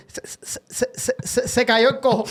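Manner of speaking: stammering se, se, se cayó; stuttered punchline. Retold or imitated. Stutterer stammering that the lame man fell, laughing.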